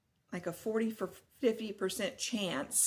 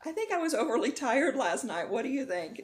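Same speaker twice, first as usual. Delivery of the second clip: high, louder, laughing through the words; 6 semitones higher, 5 dB louder than usual. Real, not acted. Storyteller asking viewers about her overtired dream, laughing. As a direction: crack up at your own story.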